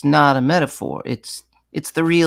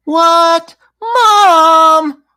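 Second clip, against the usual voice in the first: very high, loud surprised cry; surprised sing-song; 14 semitones higher, 11 dB louder than usual. Shown as a demonstration. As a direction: squeal it up in disbelief. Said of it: "That's how we talk".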